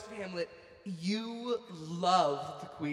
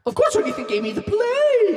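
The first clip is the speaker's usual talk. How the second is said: excited; 14 semitones higher, 12 dB louder than usual. Acted. Excited reveal of Shakespeare.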